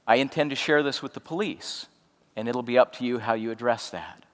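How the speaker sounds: soft but resolute, hushed; quiet and firm. Retold or imitated. To sobbing friend Patrick, quiet firm honesty. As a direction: deliver hard truth kindly. Telling friend about police.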